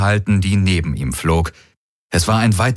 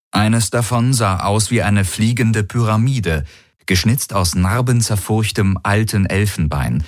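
usual speaking voice

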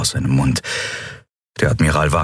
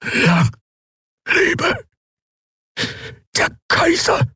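very high, voiceless dying cry; prisoner's last breath for the emperor; last gasp; slower than usual. A narrator's reading. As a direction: rasp out a final defiant cheer. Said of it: "brachte er hervor".

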